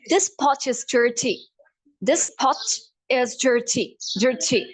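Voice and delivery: slow British accent, hard T in "dirty". Shown as a demonstration. Teacher converts pot is dirty, British accent. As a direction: hit every T crisply, British. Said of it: "how do you say in British".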